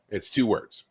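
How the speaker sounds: ordinary talk, nothing marked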